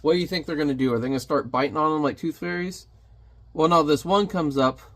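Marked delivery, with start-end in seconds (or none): none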